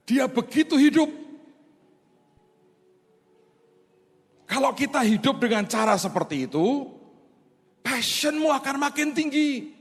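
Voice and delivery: high, loud, fervent preaching; preaching at full force. Acted. Pastor urging the congregation to know God, fervent preaching. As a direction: rouse the congregation to passion.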